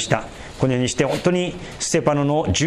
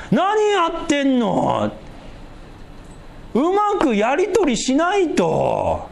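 worldly adviser voice; 8 semitones higher than usual. Retold or imitated. Pastor voicing worldly advice to Stephen, high chiding voice. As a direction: scold Stephen like a meddling neighbour. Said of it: "人間的な、あるいは日本的な考え方を今から話します".

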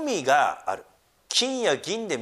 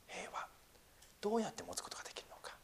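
hushed whisper; 6 semitones higher, 18 dB quieter than usual. Acted. Quiet rhetorical question to congregation.

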